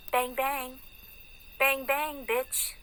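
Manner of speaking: high sing-song taunt. Acted. Woman taunting those who feel threatened by her, high and mocking. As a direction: pitch the bangs up lightly, teasing. Taunting with mock gunshot words.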